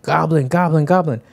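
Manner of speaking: high, repeated in-game callout; frantic teammate callout. Retold or imitated. Randos warning Hiko during the game, urgent callout. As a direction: imitate a teammate calling out a spot. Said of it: "playing with some randos and they're like".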